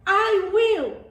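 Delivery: a hint of a buzzing bee. A narrator's reading. Bee calling out to the goat, light and eager. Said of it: "buzzed a bee".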